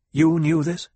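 sharp demand. A narrator's reading. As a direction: demand an answer, pitch and volume up. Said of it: "she demanded".

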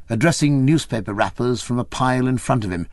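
plain reading voice

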